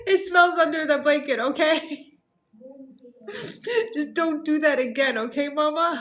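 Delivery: laughing son quote. Retold or imitated. Mother retelling her son's complaint, laughing. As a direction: quote the child while cracking up. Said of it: "He's like".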